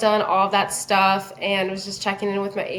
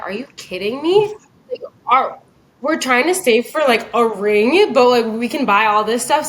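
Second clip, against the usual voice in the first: a little snippy; 3 semitones higher, 4 dB louder than usual. Retold or imitated. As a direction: retell a snippy girlfriend's complaint. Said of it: "a little bit snippy".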